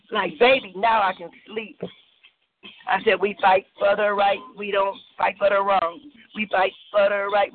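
measured rhythmic poem recital with dramatic pauses; spoken word poem. Acted. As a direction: perform it like a slam poem, savouring each line. Spoken-word love poem performance.

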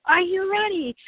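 high hyped-up call; hyped question. Retold or imitated. Organizers asking the kids if ready, high and hyped. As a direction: pump up the kids. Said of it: "they were like".

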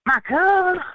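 high, loud, drawn-out Southern drawl; Southern boy. Retold or imitated. Southern boy greeting strangers in a New York bar, hammed-up drawl. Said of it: "asks the Southern boy".

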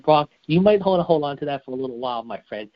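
normal talking voice

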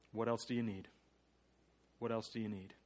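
ordinary talk

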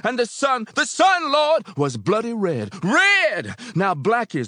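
high, loud, fervent sermon call; preacher shouting. A narrator's reading. Preacher calls to the congregation, shouting. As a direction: preach it at full force. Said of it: "the preacher shouted".

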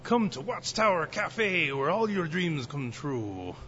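high, slow, loud pirate voice; hammed-up pirate pitch for the café. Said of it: "Why did I sound like a pirate?".

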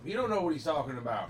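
called up loud and high to someone below; yelling up. Acted. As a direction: yell it up from a distance, stressing don't. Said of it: "The guy yells up, yells up".

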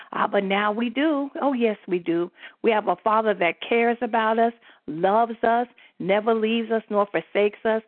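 usual speaking voice

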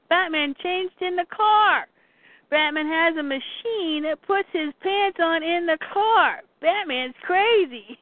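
hammed-up incredulous voice; amazed report. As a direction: blurt out an astonishing discovery. Harley amazed, telling the others.

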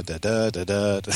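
"da, da, da, da, da" in an alternating weak-strong beat; iambic rhythm. Shown as a demonstration. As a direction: tap out "da, da, da, da, da" as iambs, every second one stressed. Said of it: "iambic pentameter".

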